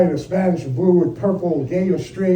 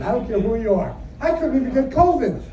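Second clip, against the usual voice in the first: normal talking voice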